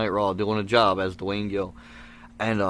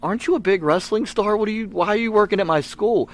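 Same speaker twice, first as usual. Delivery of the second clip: put-on high, eager kid asking a grown-up; a small boy amazed that his hero fixes lights, pitched high; nine-year-old me; 10 semitones higher than usual. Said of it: "I was nine years old".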